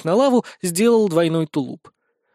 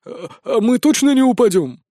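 high, anxious question; nervous stammer; 8 semitones higher, 4 dB louder than usual. A narrator's reading. Scared wizard asks leader on the lava, nervous and high. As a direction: stumble in, then plead for reassurance.

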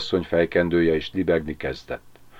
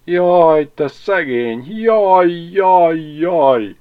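high, loud keening lament; wailing; 11 semitones higher, 6 dB louder, much slower than usual. A narrator's reading. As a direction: wail in drawn-out cries. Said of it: "fel is jajdult". (hangosan jajveszékelve)

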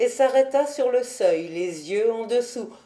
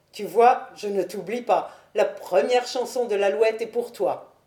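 usual speaking voice

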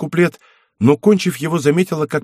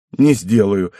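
plain reading voice

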